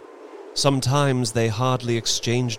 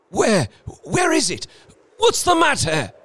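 high, loud, urgent questions; startled alarm; 5 dB louder than usual. A narrator's reading. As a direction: wake with a start and call out. Husband startled awake by screams.

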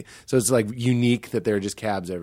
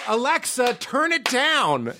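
high, slow command to Alexa; bossy command; 12 semitones higher, slower than usual. Retold or imitated. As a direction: order the speaker around. Imitating talking to Alexa.

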